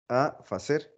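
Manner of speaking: ordinary talk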